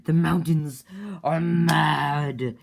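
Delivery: winged guy yelling a warning; crazed shout. Retold or imitated. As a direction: shout it like a madman flying by. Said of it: "he's like".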